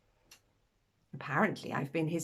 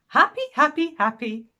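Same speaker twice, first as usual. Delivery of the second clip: put-on bouncy, higher, louder child's chirp; imitating gleeful toddler; 8 semitones higher, 8 dB louder than usual. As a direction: mimic an excited little boy repeating his word. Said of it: "Wake him up in the morning and it's".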